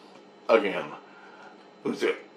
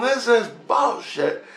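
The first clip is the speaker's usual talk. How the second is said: exasperated; 11 semitones higher, slower than usual. Retold or imitated. Acted protest at dream events.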